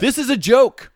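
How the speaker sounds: high, loud hammed-up yell; mock outrage. Reading angry review to co-hosts, hammed-up outrage. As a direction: shout it like a furious reviewer.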